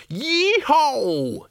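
high, triumphant whoop; crowing. A narrator's reading. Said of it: "he crowed".

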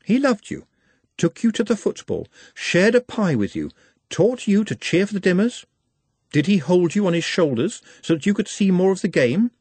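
lightly voiced, high, insistent questioning; Nutt's voice. A narrator's reading. Nutt questioning Trev about his father, in a high insistent voice. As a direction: press him gently but relentlessly.

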